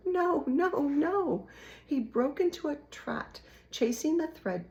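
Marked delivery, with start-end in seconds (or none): 0.1-1.5 sharp, high, loud cry; desperate cry of no. A narrator's reading. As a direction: shout it out in alarm. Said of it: "cried Despereaux".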